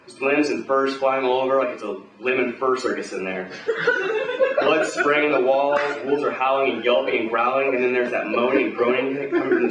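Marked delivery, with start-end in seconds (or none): 3.6-4.6 high cackling laugh. Real, not acted. Narrator laughs at own line.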